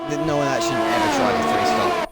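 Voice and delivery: high, loud protest; emphatic shout. Real, not acted. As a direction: shout the words down. Emphatic disagreement with co-host.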